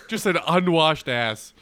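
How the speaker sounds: usual speaking voice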